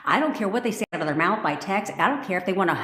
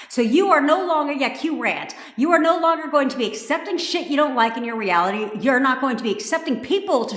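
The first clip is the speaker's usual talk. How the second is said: fired-up rant; 6 semitones higher, 4 dB louder than usual. Real, not acted. Ranting at viewers.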